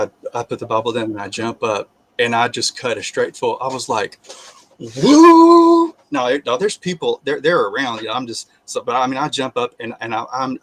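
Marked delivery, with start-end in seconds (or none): 4.8-5.9 loud high whoop. Retold or imitated. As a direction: let out a wild joyful whoop. Jumps up cutting loose.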